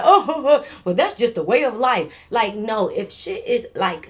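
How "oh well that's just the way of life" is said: flippant laughing line, loud. Retold or imitated. Mocking someone laughing off a death, loud laughing voice. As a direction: laugh it off carelessly. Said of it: "I'm not gonna laugh and be like".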